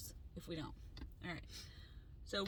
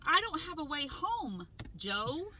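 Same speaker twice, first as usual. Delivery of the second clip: higher, slow exasperated plea to Joe; retold exasperation; 5 semitones higher, 3 dB louder, slower than usual. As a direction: stretch it out, fed up. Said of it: "Um, I was so mad".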